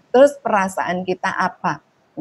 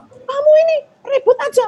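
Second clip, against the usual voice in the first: put-on shrill, much higher and louder yell at the kid; angry scolding; 6 dB louder than usual. Shown as a demonstration. Mother yelling at a noisy child, furious shout. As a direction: snap at the noisy child in anger. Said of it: "misalnya anak nakal, kita".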